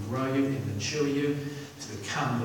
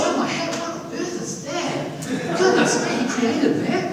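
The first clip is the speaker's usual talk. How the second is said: astonished angels; 5 dB louder than usual. Acted. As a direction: laugh in awed disbelief. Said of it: "Let there be".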